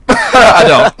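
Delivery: high laugh through the words. Real, not acted. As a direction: laugh while saying it. Laughing at the joke.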